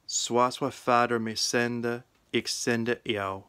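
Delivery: put-on West Saxon Old English reading, steady. Shown as a demonstration. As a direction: read the Gospel in Old English, 'queth' said as kweth. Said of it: "The reading is from the Gospel of John in West Saxon".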